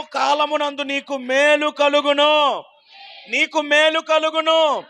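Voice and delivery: slow, declaiming promise; preaching at full force. Acted. Preacher rallies clapping congregation, full-force preaching. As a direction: hammer out each blessing slowly.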